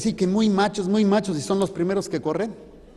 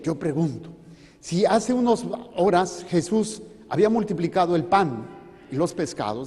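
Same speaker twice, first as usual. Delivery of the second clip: usual speaking voice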